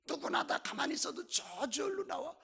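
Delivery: normal talking voice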